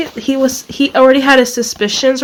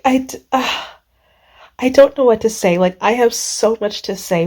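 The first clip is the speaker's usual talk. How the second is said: usual speaking voice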